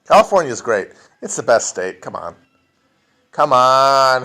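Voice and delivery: ordinary talk, nothing marked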